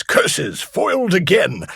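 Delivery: slow, loud villain snarl; mustache twirling villain. Retold or imitated. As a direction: spit it out like a defeated stage villain. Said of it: "Dastardly Dan goes".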